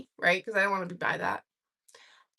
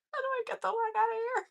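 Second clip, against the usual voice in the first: high, soft, squeezed by laughing; real giggly plea; stifled laughter; 10 semitones higher, 8 dB quieter than usual. Real, not acted. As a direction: squeak out the question while cracking up. Repeats punchline while cracking up.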